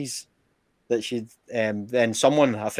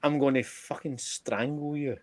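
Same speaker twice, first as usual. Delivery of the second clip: slow, soft mock threat; a hint of a strangling threat, drawn out; 6 dB quieter than usual. Threatening the prankster friend on the phone, half-laughing. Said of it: "And I was like".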